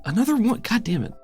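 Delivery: ordinary talk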